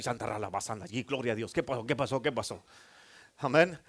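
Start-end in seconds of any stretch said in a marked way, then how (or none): 0.0-2.6 soft, drowsy mumble of someone just woken; half-asleep, lightly voiced, mumbling praise; woken-up believer. Believer woken at three in the morning, mumbling sleepily. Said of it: "tú los despiertas a las tres de la mañana y".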